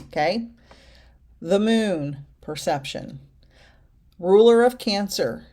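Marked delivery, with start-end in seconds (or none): none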